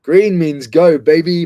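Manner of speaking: hyped. Real, not acted. Hyped car boot start.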